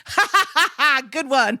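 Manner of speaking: high, fake ha-ha brushing it off; mock dismissive laugh. Comedian scoffs at the assistant with a high mock laugh. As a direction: laugh it off like she's joking.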